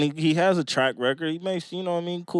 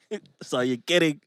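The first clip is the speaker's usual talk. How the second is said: deadpan repeat; 3 dB quieter than usual. Shown as a demonstration. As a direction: say it flat, end of discussion.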